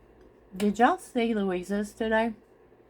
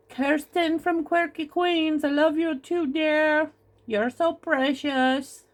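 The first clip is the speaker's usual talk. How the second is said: put-on a lightly tried Kirsten accent, higher and slower; playful stab at a friend's accent, pitched higher; attempted Kirsten accent; 10 semitones higher, 3 dB louder, slower than usual. Greeting Kirsten from Quirky Queens in a mock borrowed accent. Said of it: "I wish I could do your accent".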